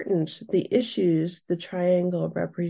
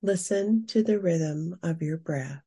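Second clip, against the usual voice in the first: guided meditation. Acted. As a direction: guide the listener gently into calm. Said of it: "Now we will move into our guided meditation".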